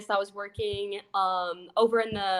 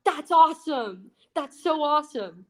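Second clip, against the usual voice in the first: enthused dad; 6 semitones higher than usual. Retold or imitated. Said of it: "dad passed the vibe check".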